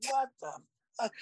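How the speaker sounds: high, startled mock cry of disbelief; shocked outburst. Waking to bug spray gas.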